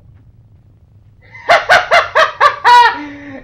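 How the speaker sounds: loud high-pitched cackling laugh; hysterical laugh. Real, not acted. Player cracking up at the game creature, hysterical laugh. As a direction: burst into a long, very high laugh.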